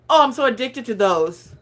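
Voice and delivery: ordinary talk, nothing marked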